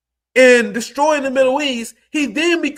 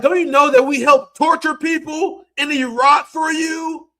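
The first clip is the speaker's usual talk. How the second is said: high, sarcastic put-on voice, lightly done; a hint of a preachy liberal; mocking liberal voice; 6 semitones higher than usual. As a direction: parrot them with a smug, mocking lilt. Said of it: "They're like".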